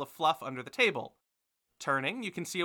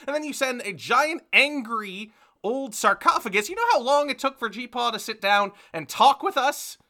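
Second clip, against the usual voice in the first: higher-pitched, exasperated tirade; scolding mother; 8 semitones higher, 7 dB louder than usual. Acted. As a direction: rant at your child, piling up complaints. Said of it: "glaring at him in a way that only mothers can glare".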